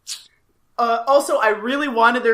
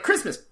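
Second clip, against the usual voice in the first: higher word, then a loud voiceless plosive pop; Ben; 5 semitones higher, 15 dB louder than usual. Acted. Showing Ben's non-catchphrase, word then plosive burst. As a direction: say it and spit out a plosive. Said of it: "then just does a plosive".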